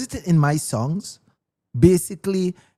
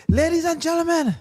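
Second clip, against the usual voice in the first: hyped MC; 13 semitones higher than usual. Retold or imitated. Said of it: "He said".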